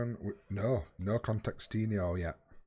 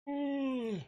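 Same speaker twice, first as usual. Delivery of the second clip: 1 high falsetto ooh. Real, not acted. Reacting to play in game.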